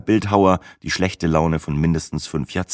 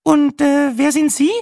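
very high, louder woman's voice; Brenda; 5 dB louder than usual. A narrator's reading. TV woman asking the old stranger's name, high-voiced. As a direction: inquire in a high, hesitant character voice.

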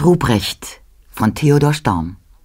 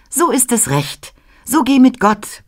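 put-on higher, pleased child's blessing; Christ child speaking; 9 semitones higher than usual. Christ child sends the loyal servant off, higher voice. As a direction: bless him gladly, high. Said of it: "Christkindlein sprach".

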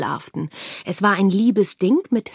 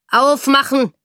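loud, raised shout of authority; blustering command; 5 semitones higher, 7 dB louder than usual. A narrator's reading. Soldier demanding entry at the door, blustering shout. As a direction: bellow an order through a door. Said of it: "polterte Esther schon an der Tür".

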